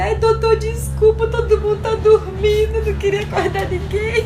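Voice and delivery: high, loud, laughing plea; laughing apology. Retold or imitated. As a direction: beg the doctor's pardon while cracking up. Retelling apology while laughing.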